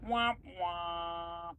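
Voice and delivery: drawn-out sad-trombone "womp womp"; mock sad trombone. Player teases a friend who forgot inspiration, mock sad trombone. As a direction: imitate a losing-game horn on held notes.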